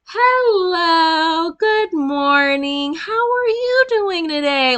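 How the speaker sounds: high, slow singsong greeting rising very high; mock an overly cheerful children's host; sugary kids' host. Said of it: "turn around and".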